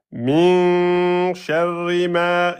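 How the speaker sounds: slow level chant, long n in "Min", long "ma"; held prolongation. Shown as a demonstration. Teacher showing the prolongation, "ma" chanted long. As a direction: hold "Min" and "ma" on one note.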